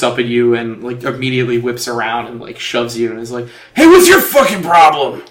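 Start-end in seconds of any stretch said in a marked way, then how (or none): 3.7-5.2 loud, angry bar brawler; angry shout. Acted. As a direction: snap at him, furious. Angry dwarf confronts player.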